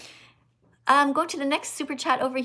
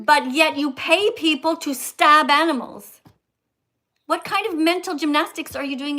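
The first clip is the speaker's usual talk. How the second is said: emphatic accusation; 4 semitones higher, 8 dB louder than usual. Real, not acted. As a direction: raise your voice and drive each word home. Heated debate over eating animals.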